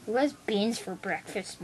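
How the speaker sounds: usual speaking voice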